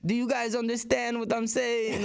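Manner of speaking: high-pitched put-on European accent; imitated foreign accent. Touring guest trying to be understood in Belgium, imitated foreign accent. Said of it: "in their accent".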